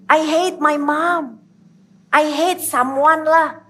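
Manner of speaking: slow, lightly voiced confession of hate; voicing a hurt listener. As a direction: let the wounded listener admit it slowly. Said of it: "yang kau hari ini berkata".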